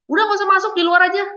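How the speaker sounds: acted host turning a guest away, loud and high; rude dismissal.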